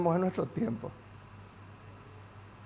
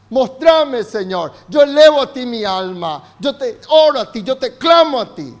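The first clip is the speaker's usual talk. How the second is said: impassioned preaching; 3 semitones higher, 8 dB louder than usual. Acted. Pastor leads the church in prayer, loud and impassioned. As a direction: build to a full-voiced plea to God.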